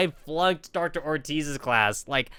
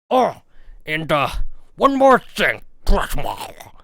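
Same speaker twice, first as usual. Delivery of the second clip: Humphrey voice again; 4 dB louder than usual. Retold or imitated. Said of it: "Doctor Humphrey".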